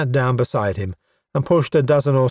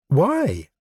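sharply raised, challenging question; demanding; 6 semitones higher than usual. Acted. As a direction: demand a reason, pitched high. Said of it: "she demanded".

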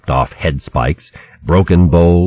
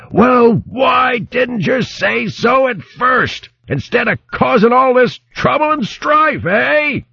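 high, mock bellowing baron voice; hollering hedgehog baron; slower than usual. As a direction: holler it back over the hare. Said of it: "the Baron hollered at a volume".

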